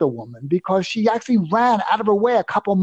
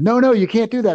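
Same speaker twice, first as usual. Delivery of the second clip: higher, louder insistent woman's voice; mock the worried runner, pitched up; imitating the woman; 7 semitones higher, 4 dB louder than usual. Retold woman insisting he stay.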